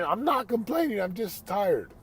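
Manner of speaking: high mock protest of the eight-year-old son; protesting kid. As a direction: push back like a tired boy insisting. Son protesting in retold argument.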